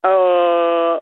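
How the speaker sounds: low ah. Real, not acted. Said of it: "maintenant un A plus grave".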